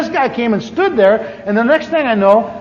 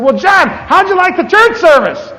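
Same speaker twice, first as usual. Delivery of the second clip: cheery friend; 10 semitones higher, 4 dB louder than usual. Retold or imitated. Friend calls cheerfully across the lawn.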